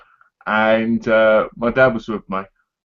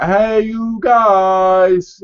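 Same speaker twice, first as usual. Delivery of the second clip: very slow, loud mock greeting; drawn-out call; 6 semitones higher, 5 dB louder, much slower than usual. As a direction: stretch the greeting out. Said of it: "it could have been like".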